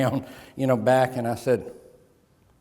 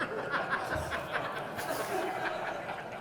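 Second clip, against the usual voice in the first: breathy high laugh; 11 semitones higher, 12 dB quieter than usual. Real, not acted. Speaker laughs at own joke.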